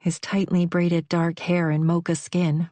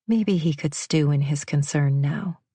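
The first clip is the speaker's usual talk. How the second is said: ordinary delivery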